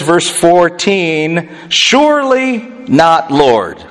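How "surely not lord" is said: acted emphatic objection; emphatic refusal. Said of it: "Peter says emphatically no".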